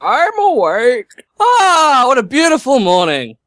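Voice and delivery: cheerful waking character. Acted.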